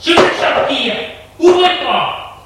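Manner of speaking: high-pitched, desperate yelling; furious cry. A narrator's reading. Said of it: "sur la porte et crie". (cri furieux, très aigu)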